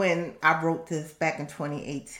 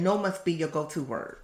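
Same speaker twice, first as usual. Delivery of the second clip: ordinary delivery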